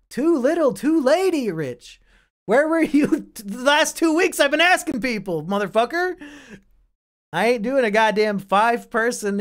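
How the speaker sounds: 高い声で大げさに怒ってみせる; 遅れて来た友達を笑いながら責め立てる; mock outrage. Real, not acted. Rants at E-Rich for joining late.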